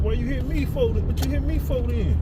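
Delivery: high-pitched, indignant bystander's protest. Acted. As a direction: act out the wrongly hit person, voice jumping up high. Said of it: "Another person you hit, like".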